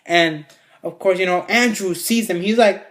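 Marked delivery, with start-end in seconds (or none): none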